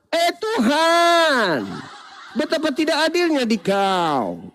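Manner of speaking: long, high wail up to God; drawn-out cockroach protest; mock shouting cockroach. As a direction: cry out in hammed-up grievance, stretching the call. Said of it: "Teriak lagi kecoa".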